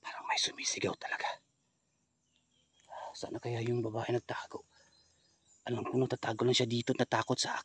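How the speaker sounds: whispering while creeping through the dark. Real, not acted. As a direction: keep it to a breath. Hiding, hears screaming.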